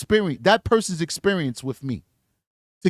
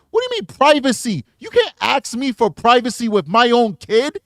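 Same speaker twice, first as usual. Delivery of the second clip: offended father; 8 semitones higher, 9 dB louder than usual. Retold or imitated. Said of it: "voicing the offended father".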